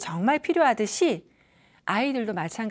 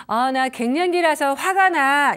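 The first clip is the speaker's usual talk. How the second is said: slow, louder mock grumble; irritated complaint; 3 semitones higher, 7 dB louder than usual. Menopausal mother excusing her temper, mock irritated. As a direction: grumble that hormones make you mad. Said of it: "이렇게 얘기를 하시잖아요".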